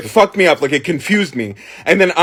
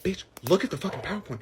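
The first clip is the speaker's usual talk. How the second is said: imitated mom muttering orders; hushed scolding; 14 dB quieter than usual. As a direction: scold under your breath, very softly. Mom hisses instructions from audience.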